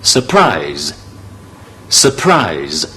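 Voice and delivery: teacher models surprise; correct pronunciation. Shown as a demonstration. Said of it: "成個字嘅讀法呢係".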